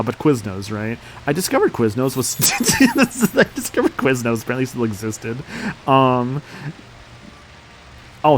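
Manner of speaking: ordinary talk, nothing marked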